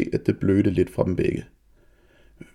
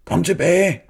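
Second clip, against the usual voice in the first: loud, high shout; man yelling at the girl to come back; 10 semitones higher, 6 dB louder, slower than usual. A narrator's reading. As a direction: call her back hard. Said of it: "råbte manden".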